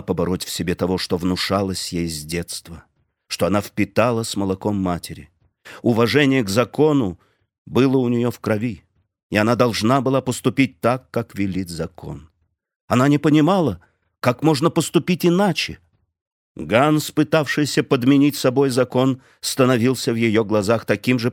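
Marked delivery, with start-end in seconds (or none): none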